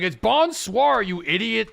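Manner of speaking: high, slow jeering chatter; mocking chat. Viewers in chat correcting the streamer, mocking. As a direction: mimic the smug viewer correcting. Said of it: "'Cause everyone's like".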